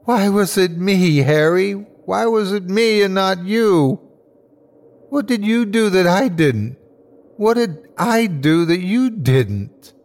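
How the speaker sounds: high-pitched, accusing outcry without full volume; anguished scream. Acted. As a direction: cry out the question in anguish. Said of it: "and I screamed".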